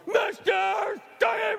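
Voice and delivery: high, loud, slow bellow; furious shout. Acted. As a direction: shout his name across the store. George storms in shouting.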